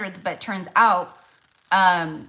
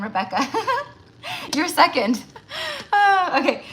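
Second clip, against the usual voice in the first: laughing through it, higher; real laugh while correcting the order; laughing at herself; 11 semitones higher, 4 dB louder than usual. Real, not acted. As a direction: giggle at your own mistake. Laughs at her own mix-up.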